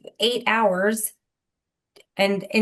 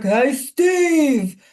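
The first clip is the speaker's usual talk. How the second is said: loud, higher New York guy yelling at his boss; brash New York accent, louder and higher; New York caller; 8 semitones higher, 5 dB louder, slower than usual. Retold or imitated. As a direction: shout it like a Brooklyn caller ranting on the radio. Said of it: "all of these Bronx and, like, Brooklyn guys who'd call".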